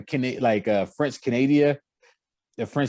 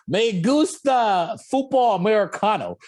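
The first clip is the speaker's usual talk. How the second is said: mock Spanish answer; 7 semitones higher than usual.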